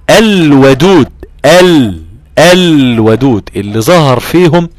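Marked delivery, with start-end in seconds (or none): 0.1-3.5 slow, loud, higher chanted recitation; recited demo. Shown as a demonstration. Teacher tells student to listen closely, then recites loudly. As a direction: let the lam ring out clearly in الودود. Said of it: "اسمع كده بأذنك، ميز".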